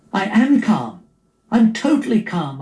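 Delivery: high, indignant insistence; arguing. Acted. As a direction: protest far too much that you're calm. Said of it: "I argued".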